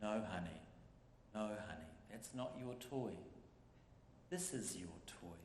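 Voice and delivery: soft, low, gentle mother's voice; calm a toddler softly; quiet reassuring mum voice. Retold or imitated. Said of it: "very calmly say".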